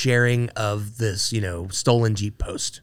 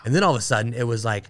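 usual speaking voice

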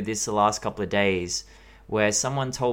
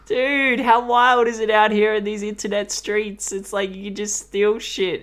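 worked-up rant; 7 semitones higher than usual. Real, not acted. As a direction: rant with rising outrage, pitched up. Excited rant about theft.

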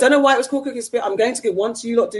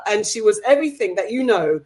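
normal talking voice

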